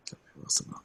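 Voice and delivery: very quiet, whispered self-talk; muttered aside. Real, not acted. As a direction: mutter it under your breath. Muttering to self before a roll.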